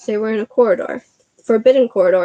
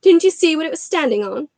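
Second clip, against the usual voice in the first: higher, exasperated question; impatient; 6 semitones higher, 3 dB louder than usual. Acted. Hermione scolding.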